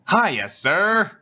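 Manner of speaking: high, chirpy cheeky hail; cheeky greeting. Acted. Grinning student hailing his furious professor, cheeky. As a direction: greet the teacher with cocky cheer.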